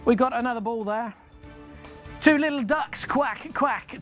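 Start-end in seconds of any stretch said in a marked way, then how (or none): 2.2-4.0 bingo caller. Acted. Bingo call with duck quacks.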